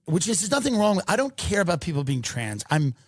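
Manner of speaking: ordinary talk, nothing marked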